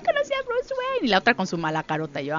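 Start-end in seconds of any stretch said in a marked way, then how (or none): 0.1-1.1 squealed, much higher fangirl gush; thrilled. Retold or imitated. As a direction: swoon over meeting a celebrity. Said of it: "Prisa estaba feliz así de". (emocionadísima, chillona)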